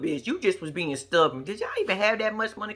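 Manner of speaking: usual speaking voice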